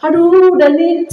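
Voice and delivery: half-sung cry of giving up; retold weeping. Preacher reliving her own crying to God, high and tearful. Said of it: "saya nangis sama Tuhan".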